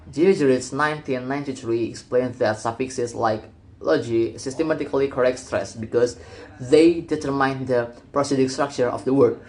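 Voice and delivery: usual speaking voice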